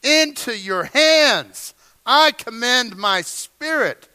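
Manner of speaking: Father cried out high and loud, slowly; loud cry. Retold or imitated. Jesus crying out from the cross, loud and slow. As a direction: proclaim it at full voice, drawn out. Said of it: "with a loud voice".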